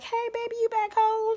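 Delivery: very high, sugary mock mom voice; sweet welcoming mom. Mocking a sweet mom welcoming her baby home, very high. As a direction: coo it like a doting mother.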